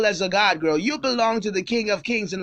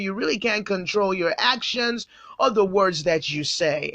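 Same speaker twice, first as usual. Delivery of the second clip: normal talking voice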